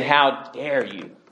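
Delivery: ordinary delivery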